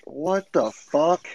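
very slow, stretched what-the-fuck; slow, baffled exclamation; drawn-out disbelief. Retold or imitated. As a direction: let it hang in stunned disbelief. Retold speechless reaction.

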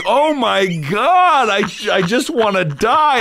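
very high, drawn-out wail; hammed-up anguish, stretching every word; mock despair. Retells his despair at the meeting.

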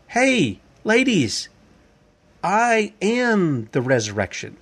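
high, slow, a hint of a Jesus voice; Jesus calling out. Jesus calls Mary and Martha, high and slow. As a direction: call to them gently but firmly, slowing on the claim.

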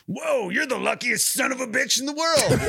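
high-pitched, exclaimed barfly's whoa; rowdy older guy. Retold or imitated. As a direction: call out in mock amazement as an older drinker. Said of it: "the one older guy goes".

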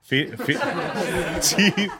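cheese breaks into a high laugh. Real, not acted. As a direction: laugh right through the word. Laughing through the word.

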